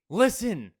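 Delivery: loud grumpy "Listen". Retold or imitated. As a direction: play the annoyed grown-up. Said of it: "I say grumpily".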